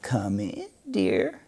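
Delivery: put-on soft, trembly old granny voice; wolf faking a frail grandmother; lightly quavering sweet old lady; quavery granny voice. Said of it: "a quavery granny voice".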